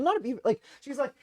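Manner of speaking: ordinary talk, nothing marked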